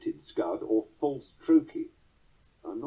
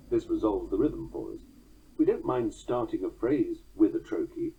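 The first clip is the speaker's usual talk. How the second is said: usual speaking voice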